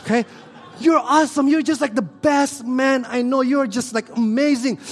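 high, loud, gushing praise; adoring wife. Retold or imitated. Wife Alice praising her husband Edric: high, loud, gushing. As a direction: gush over your husband. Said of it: "If Alice approached Edric and say".

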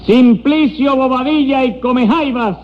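high, slow drawn-out name call; high-pitched court crier; roll call. Acted. Clerk repeats unanswered roll call.

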